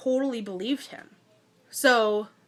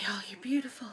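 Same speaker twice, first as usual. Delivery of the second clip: soft, whispery mock swoon; imitated dreamy near-whisper; hushed admiration; 3 semitones lower, 11 dB quieter than usual. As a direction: murmur it low as if awestruck. Voicing his adoring gaze at her.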